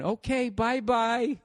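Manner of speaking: high, slow sing-song bye-bye; baby talk goodbye. Retold or imitated. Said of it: "You know how you wave to a baby?".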